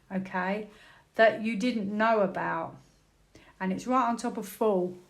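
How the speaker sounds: ordinary delivery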